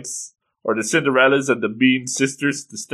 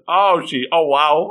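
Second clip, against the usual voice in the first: mildly breathless wonder, a little raised; so excited; 4 semitones higher, 3 dB louder than usual. Retold or imitated. Retelling how thrilled the girl is, excited. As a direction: imitate an overjoyed fan. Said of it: "she is so excited".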